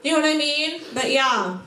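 usual speaking voice

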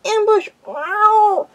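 high, loud cry of alarm; spat alarm. Acted. Graypaw attacked from behind, alarmed spitting yowl. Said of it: "spat Gray Claw".

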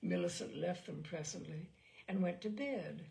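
plain reading voice

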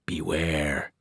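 hushed, low ghostly warning; soft, low warning from the dead; ghostly whisper. A narrator's reading. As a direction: breathe it like a spirit. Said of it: "they whispered".

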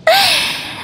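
high, soft short giggle. Acted. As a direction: giggle lightly to yourself. Character's giggle between lines.

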